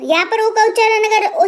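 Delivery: normal talking voice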